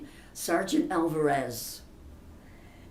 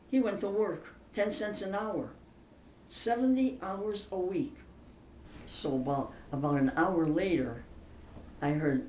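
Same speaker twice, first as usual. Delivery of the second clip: ordinary talk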